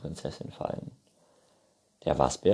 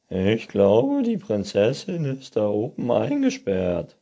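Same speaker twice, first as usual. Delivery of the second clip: loud, higher raccoon voice; Waschbär; 4 semitones higher, 8 dB louder than usual. A narrator's reading. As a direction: announce it loudly as raccoon. Raccoon figure speaking. (laute, höhere Waschbärstimme)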